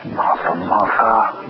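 low, slow, cool MARTHA, MARTHA; real cool. Shown as a demonstration. Preacher showing Jesus saying it with a look, real cool. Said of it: "He could have said it real cool".

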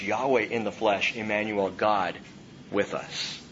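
ordinary talk, nothing marked

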